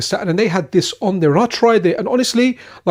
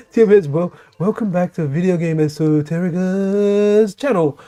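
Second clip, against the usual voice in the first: YouTuber impression. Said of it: "I can't really do his voice".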